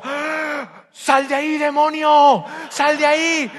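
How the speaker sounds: high, loud frightened scream; mock panicked cry. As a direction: shriek at the shouting spouse as if at a demon. Frightened spouse at first fight.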